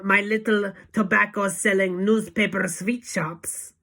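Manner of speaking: put-on high, loud witch rant in a v-for-w accent; Grand High Witch shout. A narrator's reading. Witch leader scorning cheap shops, loud accented shout. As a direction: shout it with disdain. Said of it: "shouted the Grand High Witch".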